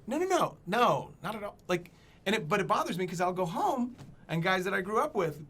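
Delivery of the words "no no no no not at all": a high burst of no, no, no, then settling; emphatic denial. Retold or imitated. Denying Kansas is the South, emphatic high no. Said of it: "And I was like".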